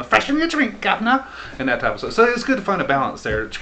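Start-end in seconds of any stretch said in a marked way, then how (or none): 0.0-1.2 much higher, hammed-up Cockney caricature; overdone Cockney like a Monty Python sketch; mock Cockney caricature. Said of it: "sound like a Monty Python character".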